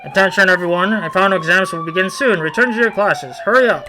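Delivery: loud, commanding school announcement; officer's announcement. Acted. School officer orders students back to class, loud and commanding. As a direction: bark orders at the students over the school speakers.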